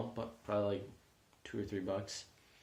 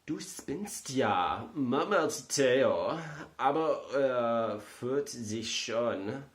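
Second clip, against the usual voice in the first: slow, higher, louder German reading voice; German accent; 4 semitones higher, 4 dB louder, much slower than usual. Pretend. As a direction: ham up a proper German accent, slowly. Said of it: "I got the German accent down".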